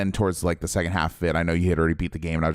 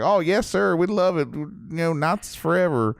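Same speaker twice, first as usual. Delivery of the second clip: imitating a Southern accent, pitched much higher; a hammed-up Southern yokel, much higher; 10 semitones higher than usual. Mocking Southern locals agreeing with a Nazi, in a high Southern accent. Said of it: "they have, like, Southern accents".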